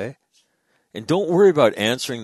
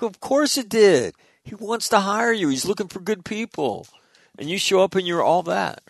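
high-pitched, emphatic retold reply; exuberant vindication; 11 semitones higher than usual. Replying that of course it worked, exuberant. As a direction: crow that you knew it all along.

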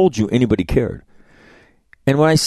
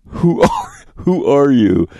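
daughter indignant; 5 semitones higher, 4 dB louder, slower than usual. Retold or imitated. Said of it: "and she says".

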